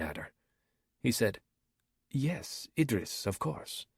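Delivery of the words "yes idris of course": put-on light African accent, softly spoken; a gentle hint of an African accent. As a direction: Somali pirate answering politely in a light African accent. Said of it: "with an African accent".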